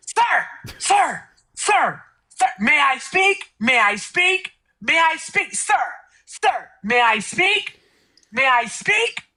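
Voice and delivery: impersonating Matt. Retold or imitated. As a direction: plead "may I speak" over and over as Matt. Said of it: "I did an impersonation of him".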